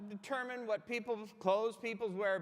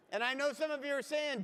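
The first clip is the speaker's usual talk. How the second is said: ordinary delivery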